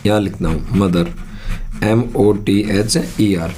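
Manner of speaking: ordinary delivery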